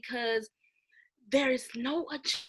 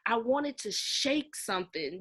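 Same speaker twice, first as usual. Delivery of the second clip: usual speaking voice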